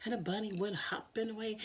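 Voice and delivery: ordinary delivery, nothing marked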